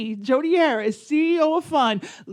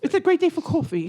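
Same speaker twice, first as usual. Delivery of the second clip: higher mock-British small talk; put-on British accent; 4 semitones higher than usual. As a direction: repeat it in a silly British accent, pitched up. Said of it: "work on our accents".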